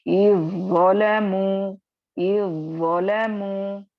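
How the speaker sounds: "इज़्ज़लमू" slow, with ज़ doubled and जाल dropped; recitation demo. Shown as a demonstration. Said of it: "आपको ज़ लाकर पढ़ना है". (धीमी तिलावत, ज़ को दोहराकर)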